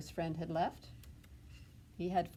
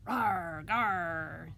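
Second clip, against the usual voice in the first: monster growl; 4 dB louder than usual. Acted. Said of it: "says Ben".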